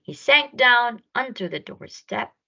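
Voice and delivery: ordinary delivery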